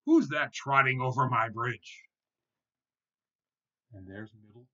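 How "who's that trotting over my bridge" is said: loud storybook troll demand; troll's roar. A narrator's reading. Troll demands who trots over his bridge, roaring. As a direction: demand it at full voice. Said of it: "he roared".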